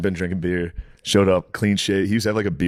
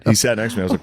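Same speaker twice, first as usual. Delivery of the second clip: ordinary talk, nothing marked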